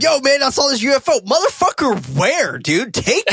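high-pitched, hyped-up reaction; excited role-play. Acted. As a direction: act wildly eager to see it. Acts out eager reaction to UFO.

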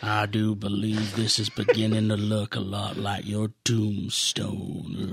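Clint Eastwood growl. Shown as a demonstration. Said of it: "you could go really Clint Eastwood with it".

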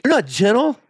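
high, rising disbelief; retold indignant objection; indignant protest. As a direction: throw it back at God, pitched high. Retelling his protest to God.

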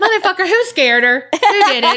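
high, hammed-up accusation; comic high-pitched indignation; mock outrage. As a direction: demand it in playful outrage, close to laughing. Acting out group's mock outrage.

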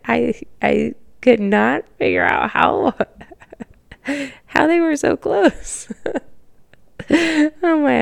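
giggling through the words, voice jumping high; can't stop laughing, real giggles; laughing while talking. Real, not acted. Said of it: "I don't know why I'm laughing".

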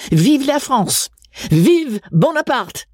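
high, ringing mock toast, the last one drawn out slowly; defiant cheer. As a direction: throw it out as a rebellious cheer.

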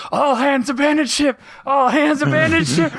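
high, panicked shipboard alarm cry; shouting abandon ship. Pretend. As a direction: yell the evacuation order in a panic. Pictures the Q and Lwaxana scene.

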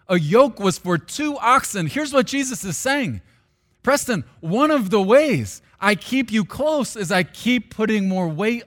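loud, high impassioned preaching; preaching at full force. Acted. Pastor preaching to his church, loud and impassioned. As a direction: pour it out over the congregation.

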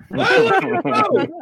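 high, tearful mock blubber on the line; mock weeping. Host recalls crying at Rocky, high mock tearful. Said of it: "I was weeping".

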